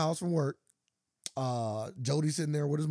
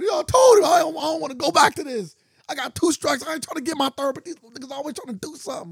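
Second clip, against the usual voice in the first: high, loud imitated pleading rant; hammed-up protest; 10 semitones higher, 8 dB louder than usual. Retold film character won't go back to prison, high frantic shout. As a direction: protest loudly in a high put-on voice. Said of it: "And then she said".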